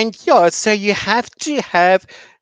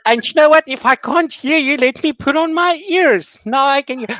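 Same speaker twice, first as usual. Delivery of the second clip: playful teacher voice; 8 semitones higher than usual. Shown as a demonstration. Teacher showing how she plays with her young students, high and playful. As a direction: play along like a kindergarten teacher.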